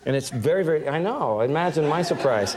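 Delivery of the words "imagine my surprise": ordinary talk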